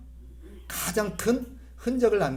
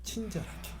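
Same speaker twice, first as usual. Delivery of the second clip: soft kindly; 5 semitones higher, 11 dB quieter than usual. Shown as a demonstration. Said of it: "어떻게 해야 될까요?".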